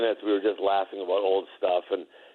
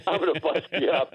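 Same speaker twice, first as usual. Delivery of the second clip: laughing; 5 semitones higher than usual. Retold or imitated. Larry taunting Mason, teller laughing.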